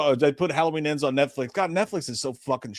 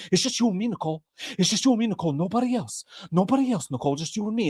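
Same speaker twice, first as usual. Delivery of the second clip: high, doting creep voice; creepy lover voice; 6 semitones higher, faster than usual. Acted. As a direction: croon it like an obsessed lover. Acted creepy promise to Nicole.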